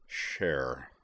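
low, understated desperate plea; restrained desperation. A narrator's reading. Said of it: "Moose said in a desperate tone".

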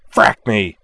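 loud snarled curse. A narrator's reading. Pilot finds her guns switched off, snarling. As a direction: spit it out in sudden frustration. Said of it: "she snarled".

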